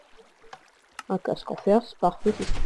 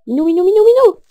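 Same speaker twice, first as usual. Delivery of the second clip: appel fort; 11 semitones higher, 11 dB louder, faster than usual. Real, not acted. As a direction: call the cats loudly, rising high. Calling the cats loudly. (appel crié, de plus en plus aigu)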